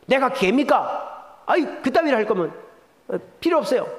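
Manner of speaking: mildly acted indignant snap; hammed-up offended refusal; mock angry outburst. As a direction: fake a flare of temper. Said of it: "화를 버럭 내면서".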